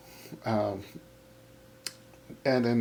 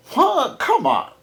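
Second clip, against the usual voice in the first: ordinary talk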